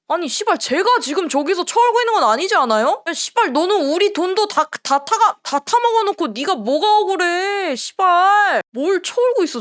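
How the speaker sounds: high-pitched angry rant at a player; 격앙된 분통. Real, not acted. (언성을 높인 분노의 질타)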